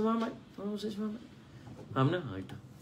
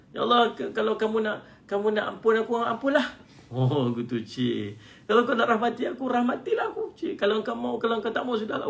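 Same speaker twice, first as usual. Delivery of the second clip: higher, careless take-it-or-leave-it tone; pushy demanding prayer; 10 semitones higher, 4 dB louder than usual. Pretend. Preacher acts a worshipper bargaining with God, a higher careless demand. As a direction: act out someone daring God. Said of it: "ada yang memaksa Allah".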